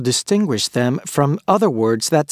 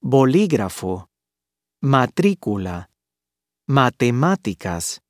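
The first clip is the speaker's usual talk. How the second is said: bo-LÍ-grafo, ma-TRÍ-cula, mate-MÁ-ticas; third-to-last stress. Shown as a demonstration. Teacher models the rule, stressing each word on its third to last syllable. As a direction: stress LÍ in "Bolígrafo", TRÍ in "Matrícula", MÁ in "Matemáticas". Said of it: "stressed on the third to last syllable".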